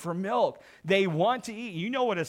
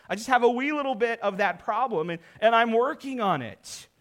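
higher and louder, pleading that it's only a wee bit; mock defensive congregant; 6 semitones higher, 6 dB louder than usual. Pastor mimicking a churchgoer's excuse, mock pleading. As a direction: play the excuse-making churchgoer, hammed up. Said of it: "And if you would say".

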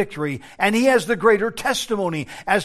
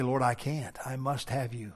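quiet plea; 4 semitones lower, 8 dB quieter than usual. Retold or imitated. Praying in weakness.

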